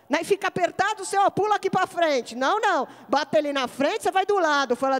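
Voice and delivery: high, ringing exhortation; full-force preaching. Real, not acted. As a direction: preach it out with conviction.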